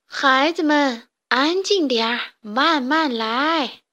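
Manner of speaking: slow, loud call over noisy chicks; teacher calling the class to order. A narrator's reading. Teacher shouts slowly to noisy chicks, loud and drawn out. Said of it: "大声地嚷嚷着".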